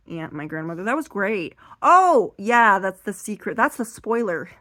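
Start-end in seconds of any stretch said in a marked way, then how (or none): none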